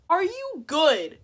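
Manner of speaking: high, loud, slow demand; acted-out high indignant question; incredulous challenge. First take of furious retort.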